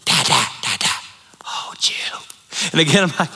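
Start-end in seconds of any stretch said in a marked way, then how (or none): 0.0-2.2 put-on tiny child pleading at night; little girl's voice. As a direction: whisper like a sleepy two-year-old. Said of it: "I feel this little pat".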